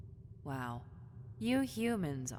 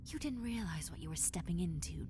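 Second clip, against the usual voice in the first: ordinary talk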